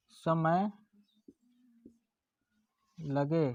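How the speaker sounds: slow dictation. Shown as a demonstration. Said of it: "dictating definition".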